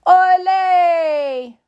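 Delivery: high, loud, stretched cheer; shouting. Acted. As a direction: shout it out like a crowd of amigos. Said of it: "shouted his amigos".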